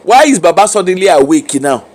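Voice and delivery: normal talking voice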